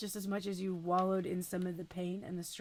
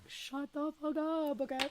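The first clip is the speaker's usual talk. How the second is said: stunned disbelief; 6 semitones higher than usual. Retold or imitated. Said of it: "I was like".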